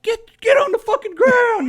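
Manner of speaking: very high, loud barked command; police yelling. Retold or imitated. SWAT officers shouting at the suspect to get down, very high and loud. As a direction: scream the order like a SWAT cop. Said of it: "where they're like".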